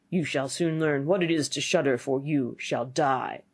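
low villain voice, slowing at the end; menacing old man. Acted.

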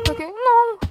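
loud, high-pitched cry of alarm; startled. Real, not acted. Player refusing to go on after a sudden fright, startled. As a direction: recoil loudly from a sudden scare. Startled by something in horror game.

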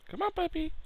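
very high squeaky falsetto; high puppy-talk. Acted. Player calling a puppy, high cooing falsetto. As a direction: coax a puppy in a tiny, sky-high voice.

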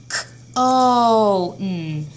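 teacher reinforcing sounds of cone; sounding out. Shown as a demonstration. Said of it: "we hear that O sound".

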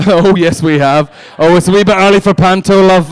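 loud teasing panto response to the audience; mock panto callback. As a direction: boom it back like a pantomime dame. Calling back to the laughing crowd.

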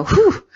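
high, loud sigh of relief; relieved whew. Retold or imitated. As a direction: blow out the tension with a whew. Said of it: "shake it off at the end of the day and go".

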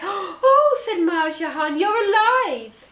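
high, loud laughing cry of relief; tearful joy. Acted. Merchant sees her parrot fly, laughing through tears. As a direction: burst out in joyful disbelief.

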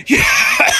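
very high, squealing scream of triumph; screamed cheer. Real, not acted. Player escaping Baldi in the game, screamed cheer. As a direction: scream it out with a laugh.

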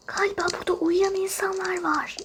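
fast hushed whisper; quick whisper. Acted. A kid telling friends people sleep here, whispering. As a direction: rush it out under your breath.

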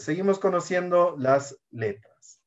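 ordinary talk, nothing marked

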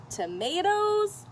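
plain reading voice

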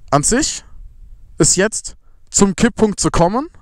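usual speaking voice

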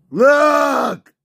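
high, loud yell; insistent shout. Real, not acted. Player demanding attention, high loud shout. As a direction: shout it to make them look.

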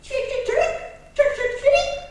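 a sparrow practising its chirp. Acted. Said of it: "I tak zaczął ćwiczyć".

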